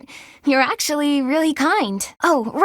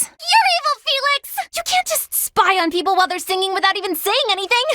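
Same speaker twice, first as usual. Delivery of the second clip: embarrassed outburst; 6 semitones higher than usual. Acted. Caught singing, scolding Felix.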